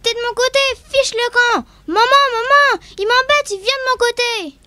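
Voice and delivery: high loud complaining shout; shrill yelling child. Acted. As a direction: yell to mom for help.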